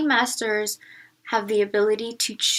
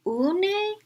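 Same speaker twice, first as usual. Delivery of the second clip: puzzled; 8 semitones higher than usual. Acted. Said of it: "scrunching up her face".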